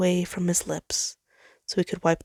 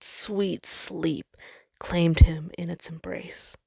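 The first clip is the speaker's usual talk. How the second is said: usual speaking voice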